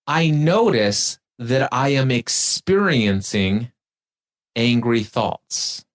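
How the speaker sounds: slowly, each word placed; slow, deliberate. Shown as a demonstration. Host models the detached phrase slowly for the guest. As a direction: read it out like a calm instruction. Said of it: "I want you to say these words".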